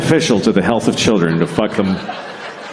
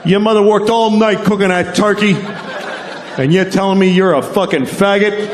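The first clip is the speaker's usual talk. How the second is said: mock angry dad; 9 semitones higher than usual. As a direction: rant like a furious old-school dad. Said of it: "dad gets angry".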